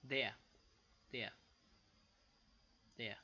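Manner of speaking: normal talking voice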